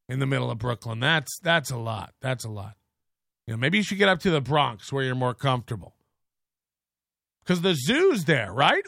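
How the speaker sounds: normal talking voice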